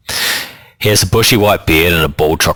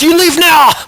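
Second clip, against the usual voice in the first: much higher and louder bark; barked order; 5 dB louder than usual. A narrator's reading. Old Kaumatua barking at Mona to leave, loud command. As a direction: bark it right in her face. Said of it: "he barks at me".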